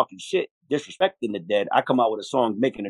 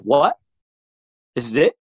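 a rising, incredulous "what?"; mock surprise; 5 semitones higher, 5 dB louder than usual. Pretending to get plain chicken, mock surprised and louder. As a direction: act baffled by plain food.

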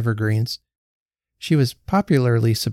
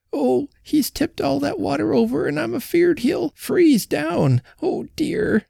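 put-on fretful old woman. A narrator's reading. Old Betsy frets to Jenny about her sulking husband, high and anxious. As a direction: let an anxious old wife's voice quaver.

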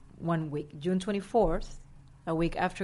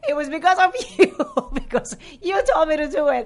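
much higher and louder, fast, laughing through it; giddy laughing gush; 9 semitones higher, 9 dB louder than usual. Retold or imitated. Thanking James for the push, gushing with laughter. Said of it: "I'm going".

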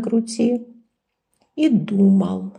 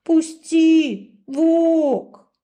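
little sheep pleading; 9 semitones higher, 3 dB louder, much slower than usual. A narrator's reading. Sheep knocking, calling.

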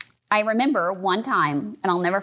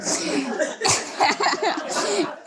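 high nervous giggle; short high laugh; 8 semitones higher than usual. Real, not acted. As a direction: laugh at the absurdity. Laughs after googling.

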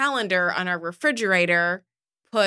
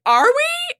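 put-on high child voice; 6 dB louder than usual. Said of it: "she's like".

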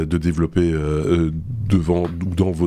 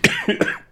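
sudden choking cough; coughing fit, choking. Real, not acted. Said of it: "Pardon, je m'étouffe".

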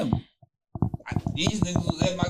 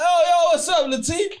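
excited greeting; 10 semitones higher than usual. Retold or imitated. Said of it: "I'm like".

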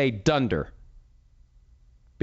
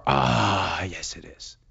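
pained croak; 6 semitones lower, much slower than usual. Retold or imitated. Answering his own sore throat at night, pained croak.

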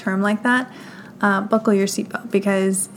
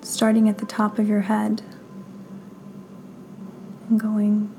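guided meditation; 5 dB quieter than usual. Acted. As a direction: guide them gently through breath and body, unhurried.

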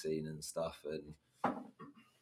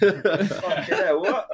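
loud, slow, laughing shock; laughing mock astonishment; 12 semitones higher, 14 dB louder, much slower than usual. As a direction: drag out the disbelief.

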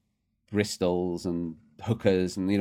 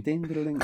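ice cream van chime; 8 semitones higher than usual. Retold or imitated. Recalling the ambulance that was an ice cream van, high chiming hum. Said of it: "the music came in".

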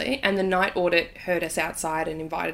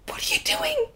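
much higher, alarmed imitation; panicked partner. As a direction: squeal it like the startled husband.

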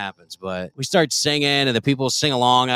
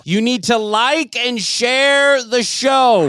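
mock-stern shouted plug; 8 semitones higher, slower than usual. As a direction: break the hush with a drawn-out holler. Loud plug after mock silence.

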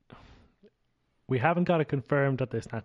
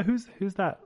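ordinary talk

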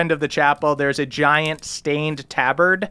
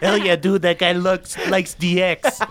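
put-on higher-pitched rowdy teen voice; excited teenage kid; 5 semitones higher than usual. Kids cheering on the newcomer's rude gesture, excited teen voice.

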